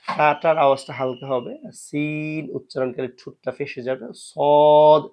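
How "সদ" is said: সদ much louder, lips rounded; heavy sad. Shown as a demonstration. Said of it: "উচ্চারণ করে ঠোঁটটা গোলাকৃতির হবে". (ঠোঁট গোল করে জোরে সদ)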